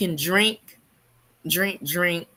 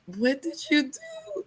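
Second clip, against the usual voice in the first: high, drawn-out mock disbelief; high, stretched-out incredulous question; retold alarm; 9 semitones higher, slower than usual. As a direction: imitate an aghast brother, pitched up and slow. Brother's alarmed reaction, acted out.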